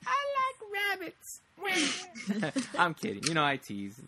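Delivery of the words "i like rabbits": put-on very high, slow, childish squeak; mock a little sister's silly voice; teasing imitation. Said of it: "and you're like".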